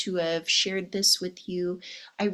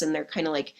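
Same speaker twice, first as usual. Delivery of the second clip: normal talking voice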